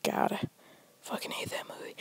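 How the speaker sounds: sigh, then a soft whispered gripe; whispered aside. Real, not acted. Griping to listeners about the movie, whispered. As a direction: breathe it out under your breath.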